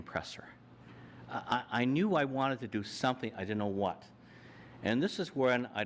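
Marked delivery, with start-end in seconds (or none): none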